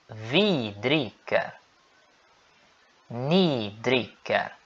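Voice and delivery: slow, clear repeat-after-me conjugation; långsam övningsuppläsning; slow drill. Shown as a demonstration. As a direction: spell it out slowly for learners to follow. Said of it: "Sigam comigo".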